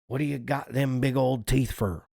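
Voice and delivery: plain reading voice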